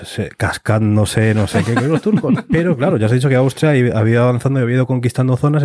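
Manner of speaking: ordinary talk, nothing marked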